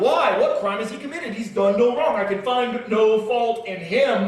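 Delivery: high, loud pleading shout, the last line slowed; Pilate screaming. Acted. Pilate defends Jesus to the crowd, high loud shout. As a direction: shout the question at the mob. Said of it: "Pilate screamed out to the crowd".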